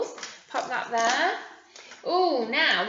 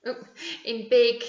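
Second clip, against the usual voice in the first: normal talking voice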